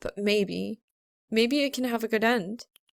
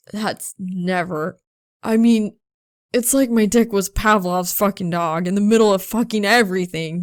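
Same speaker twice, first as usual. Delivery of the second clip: put-on louder, worked-up young man; flustered, louder confession; agitated rambling; 6 dB louder than usual. A narrator's reading. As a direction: pull at your hair, blurting it all out.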